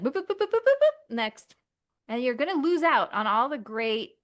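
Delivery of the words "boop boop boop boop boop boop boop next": dismissive rushing. Pretend. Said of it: "you're gonna just be like".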